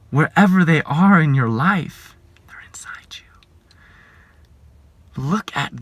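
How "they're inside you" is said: breathy voiceless whisper; soft whispered confidence. Real, not acted. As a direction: lean in and whisper the secret. Intimate warning to congregation.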